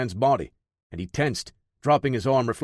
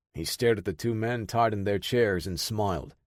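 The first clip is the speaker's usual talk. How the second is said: plain reading voice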